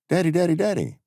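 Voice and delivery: high-pitched imitation of a little girl; child calling. Little girl at the top of the slide, child calling. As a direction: mimic a kid calling for attention, pitched well up.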